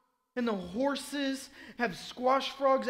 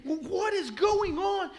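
high, loud, drawn-out bewilderment; bewildered outburst; 8 semitones higher, 6 dB louder, slower than usual. Acted. Man waking to frogs all over his room, bewildered outburst. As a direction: cry it out slowly, high and baffled. Acting out bewildered frog victim.

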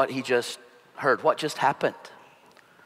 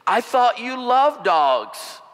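astonished neighbor; 8 semitones higher, 3 dB louder, much slower than usual. Retold or imitated. Neighbor asks after the dog kick.

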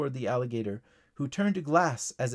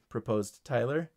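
ordinary delivery

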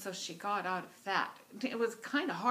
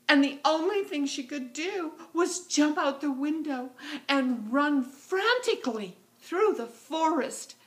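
higher, louder, breathless rush; frantic narration; 8 semitones higher, 9 dB louder than usual. A narrator's reading. Narrator tells Goldilocks fleeing the bears, frantic. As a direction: race through it in alarm.

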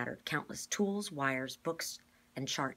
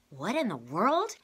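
surprised; 6 semitones higher, 8 dB louder, slower than usual. A narrator's reading. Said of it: "Kate said".

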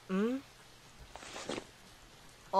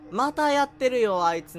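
slow, louder exasperated drawl at the enemy; 5 semitones higher, 9 dB louder, slower than usual. Real, not acted. Gamer fed up with a looping enemy, exasperated drawl. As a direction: groan it out, fed up.

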